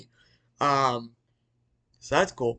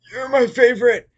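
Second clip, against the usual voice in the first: high, loud mock yell; hammed-up villain cry; 10 semitones higher, 9 dB louder than usual. [name] defying Vanessa as she shoots, hammed-up yell. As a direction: belt it out as an over-the-top villain.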